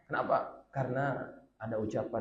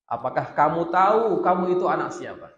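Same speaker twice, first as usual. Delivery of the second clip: stern rebuke; 6 semitones higher, 9 dB louder than usual. Acted. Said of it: "Rasulullah langsung dia naik pitam".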